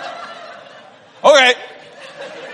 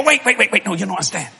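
wife putting off; 4 semitones lower, 5 dB quieter, faster than usual. Retold or imitated. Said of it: "They go".

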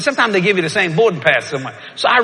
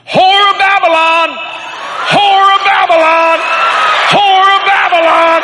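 slow, high, full-voiced shout; loud shout; 13 semitones higher, 7 dB louder, slower than usual. Preacher shouting at woman on plane, loud and slow. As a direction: yell it as loud as you can. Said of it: "I said it as loud as I could".

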